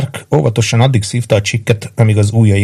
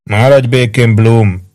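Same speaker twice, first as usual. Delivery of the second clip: clear, ringing call, a bit louder; 4 dB louder, slower than usual. A narrator's reading. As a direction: cut through the silence. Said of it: "milyen tisztán cseng a hangja". (csengő, tiszta hangon)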